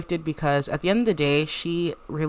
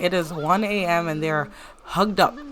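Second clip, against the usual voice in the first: ordinary talk, nothing marked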